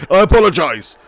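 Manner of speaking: normal talking voice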